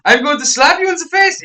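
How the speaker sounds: put-on high, loud hammy German accent; a put-on German accent, pushed up. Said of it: "my German accent".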